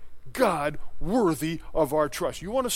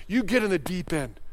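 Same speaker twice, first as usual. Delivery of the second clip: normal talking voice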